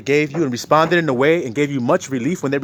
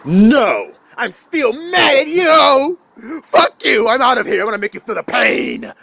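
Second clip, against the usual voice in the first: higher and louder, slow spiteful rejection; mock angry refusal; 6 semitones higher, 4 dB louder, slower than usual. Spouse rejecting a sincere apology, mock furious. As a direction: throw the apology back in their face.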